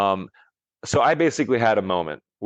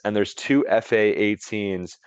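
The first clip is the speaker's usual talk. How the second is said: normal talking voice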